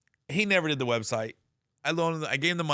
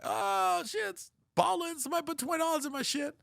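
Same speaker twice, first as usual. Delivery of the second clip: much higher, fast, giddy put-on voice; mock excited surprise. Imagining himself spotting a small deposit, mock giddy voice. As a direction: squeal with mock delight at twenty dollars. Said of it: "Be like".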